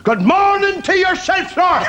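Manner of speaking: slow, very high pig squeal; talking pig. Acted. Pig answering the ventriloquist's greeting, squealed. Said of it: "and the pig says".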